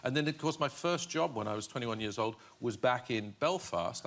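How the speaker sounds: ordinary talk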